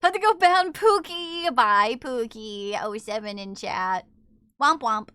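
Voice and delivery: usual speaking voice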